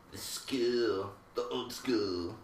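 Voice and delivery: playful try at an English accent; lightly put-on English accent. Shown as a demonstration. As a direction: practice the words in an English accent. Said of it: "back to practicing English accent".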